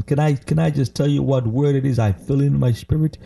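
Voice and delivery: usual speaking voice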